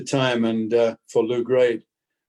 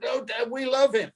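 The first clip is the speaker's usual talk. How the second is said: much higher, a light mock child's voice; a quick, lightly played kid's voice, higher; imitating the kids; 11 semitones higher than usual. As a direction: pipe it up like his kids pleading with Dad. Kids quoted by their father.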